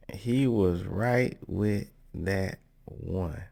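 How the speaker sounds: word by word, with pauses between; slow right down, set each word apart; slow emphatic repeat. Real, not acted.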